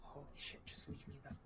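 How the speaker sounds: frantic hissed whisper; panicked whisper. Acted. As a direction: whisper it in terror. Lily panicked, cornered by creatures.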